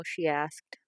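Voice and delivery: ordinary delivery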